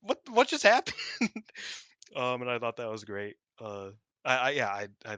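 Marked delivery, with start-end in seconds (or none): 0.0-0.9 high mock outrage, laughing through it; baffled Mayu. Imitating Mayu confused by the yelling, high laughing voice. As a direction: squeak it out in disbelief, cracking up. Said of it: "He's like".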